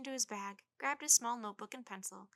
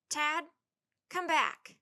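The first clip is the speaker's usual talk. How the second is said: loud, high call; 6 semitones higher, 11 dB louder, much slower than usual. A narrator's reading. Willie calls his little brother back, loud call. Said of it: "called Willie".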